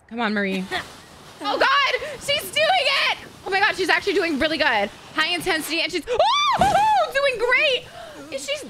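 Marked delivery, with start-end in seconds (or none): 1.4-8.6 excited squeal. Real, not acted. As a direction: squeal in delight. Watching Marie ski.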